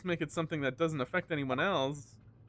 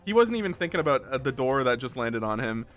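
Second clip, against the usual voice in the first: usual speaking voice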